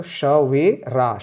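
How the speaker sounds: slow, श वे रा each said apart; syllable by syllable. Shown as a demonstration. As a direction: sound out श वे रा slowly. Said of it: "रा पे मुंह खोलना है". (अक्षर-अक्षर धीरे बोलकर)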